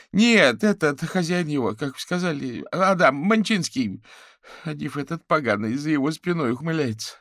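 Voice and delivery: high, loud indignant lament; panicked caller. Acted. Fetisov complains about the new master, agitated and aggrieved. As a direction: spit out the complaint, frantic and high.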